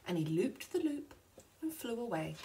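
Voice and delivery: ordinary delivery, nothing marked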